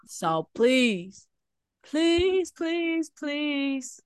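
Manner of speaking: usual speaking voice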